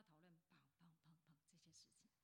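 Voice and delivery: whisper. Retold or imitated. Glosses over the agenda.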